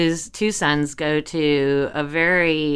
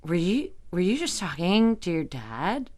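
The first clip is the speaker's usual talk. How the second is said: softer, halting disbelief; wide-eyed shock; 6 dB quieter than usual. Retold or imitated. Dorm friends questioning her call to dad, hushed imitated shock. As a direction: imitate friends stunned and tentative. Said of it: "look at me with these wide eyes".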